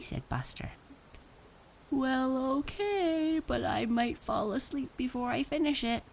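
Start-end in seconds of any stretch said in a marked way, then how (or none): 1.9-6.0 reluctant agreement. A narrator's reading. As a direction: give in reluctantly in a high-pitched voice. Sleepy Max reluctantly agrees to read.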